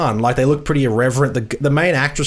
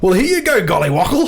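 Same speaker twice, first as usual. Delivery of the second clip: put-on British accent; 3 semitones higher than usual. Host struggling through his bit, badly faked British accent. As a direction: lay on a daft British accent. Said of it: "my British, British accent".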